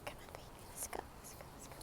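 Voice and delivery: very soft, whispered urging; real quiet aside; hushed coaxing. Real, not acted. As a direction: coax a little one along under your breath. Coaxing baby or pet off-mic.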